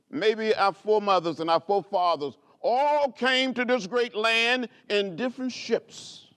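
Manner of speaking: stirring public address; rousing oratory. Acted. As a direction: preach it to the crowd, then bring it home. Quoting movement leaders from podium.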